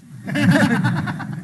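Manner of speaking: a hearty burst of laughter. Real, not acted. Laughing after joking about his Schwarzenegger accent. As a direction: laugh it off openly.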